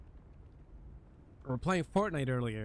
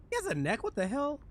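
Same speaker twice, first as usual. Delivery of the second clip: surprised; 9 semitones higher than usual. Real, not acted. Sees the neck on the skin.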